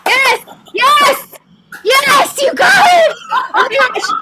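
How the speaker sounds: high, loud joyful shouts of yes; excited cheering. Real, not acted. Host cheering the contestant who guessed The Matrix, shrieking with delight. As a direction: cheer the winner on, almost crying with joy.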